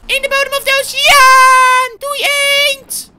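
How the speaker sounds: high, very loud whooping goodbye; gleeful send-off shout. Acted. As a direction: shriek farewell as you ditch it. Hurls the duck into the depths.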